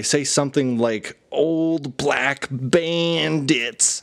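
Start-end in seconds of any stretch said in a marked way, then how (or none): 1.3-4.0 drawn-out, higher-pitched whine; very slow, whiny chant; grainy, whiny voice. Retold or imitated. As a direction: imitate a whiny mantra, stretching every word. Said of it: "like, a grainy, whiny voice".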